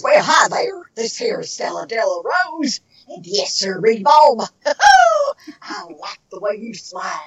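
put-on nutty old country lady voice; hammed-up country lady greeting with whooping cackle; Stella Della Rose. Shown as a demonstration. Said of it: "she talks like this".

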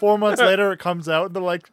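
normal talking voice

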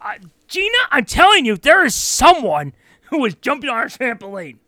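high, loud, alarmed homeowner; a homeowner calling out into the dark. Retold or imitated. Homeowner hunts intruder at night.

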